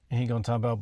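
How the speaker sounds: ordinary talk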